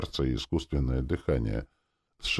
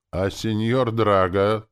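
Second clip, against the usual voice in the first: slow, higher, louder puzzled question; dim questioning; 6 semitones higher, 7 dB louder, slower than usual. A narrator's reading. Soldier insisting on his old lord, puzzled. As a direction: draw out the name, still unsure. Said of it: "спросил солдат".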